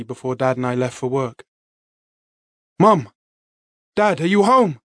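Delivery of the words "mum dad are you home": calling loudly for parents; shouting. Acted. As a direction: shout through the empty house. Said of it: "I shouted".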